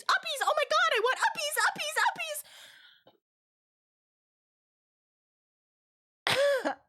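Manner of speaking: very high, squealing plea; mock begging. Storyteller mimicking coworkers begging her for uppies, hammed-up squeal. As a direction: squeal it like an overexcited toddler's demand.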